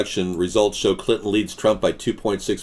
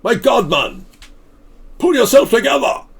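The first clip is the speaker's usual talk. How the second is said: much higher, loud exasperated chef shout; Chef Ramsay; 5 dB louder than usual. Retold or imitated. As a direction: yell like a furious TV chef. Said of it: "Not even Chef Ramsay".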